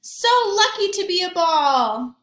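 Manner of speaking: high, loud sing-song delight; sing-song joy. Acted. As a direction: sing it out happily. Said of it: "Ball said".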